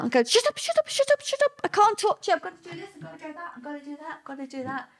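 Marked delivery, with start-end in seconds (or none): 0.2-2.4 high-pitched, frantic shushing at normal volume; agitated retelling of "don't interrupt me"; agitated brush-off. Retold or imitated. Acting out snapping at her daughter. 2.4-4.9 very soft, high-pitched muttering to oneself; hushed, higher-pitched self-talk; compulsive muttering. Retold or imitated. Acting out her compulsive bedtime routine.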